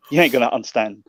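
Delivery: laughing while quoting himself; half-laughed, amused retort; laughing through words. Retold or imitated. Retelling his own reply.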